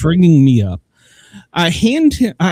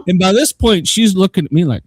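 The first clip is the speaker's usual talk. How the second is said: ordinary talk, nothing marked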